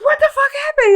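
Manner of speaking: very high imitation of a shocked friend; mock alarm. Friend on the road demanding answers, high alarm.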